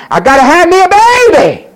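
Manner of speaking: high, loud mock yell; obsessive demand. Preacher as Hannah demanding a child, obsessive yell. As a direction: cry it out as a desperate demand. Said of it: "prayed on this one thing".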